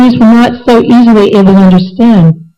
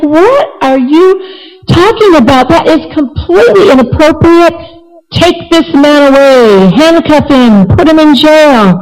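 higher-pitched acted outrage; irate king; 6 semitones higher than usual. Irate king orders his minister jailed, indignant higher voice. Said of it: "the king became so irate".

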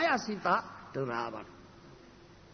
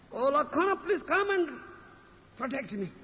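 higher pleading call, storyteller's imitation; retold cry for help; 6 semitones higher than usual. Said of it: "shouted, cried, the voice of".

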